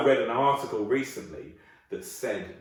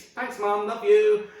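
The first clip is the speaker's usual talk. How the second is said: put-on high, loud child thanking mum; chirpy kid gushing thanks; high-pitched sweet child; grateful kid; 10 semitones higher, 7 dB louder, much slower than usual.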